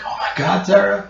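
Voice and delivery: lightly acted father overcome by tears; crying father. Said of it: "and he's, he's crying".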